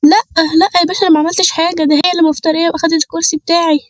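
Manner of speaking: hammed-up panicked plea; pleading old woman. Old woman begging officer.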